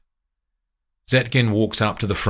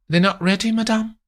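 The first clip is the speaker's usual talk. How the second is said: high, confused; high, uneasy; puzzled maid. Acted. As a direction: ask why, unsure. Maid protesting.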